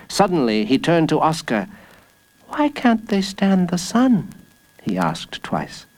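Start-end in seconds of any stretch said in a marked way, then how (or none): 2.5-4.3 put-on higher, curious boy; puzzled little boy's question; child's voice. A narrator's reading. Jacob asks Oscar about the guards, child's voice.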